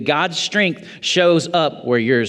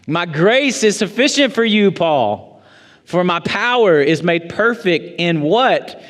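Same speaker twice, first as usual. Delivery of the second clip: raised, driving preacher's voice; preaching; 5 semitones higher than usual. Acted. Pastor preaching on Paul's thorn to the church, raised and driving. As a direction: preach it out to the room, rising. Preaching builds to full force.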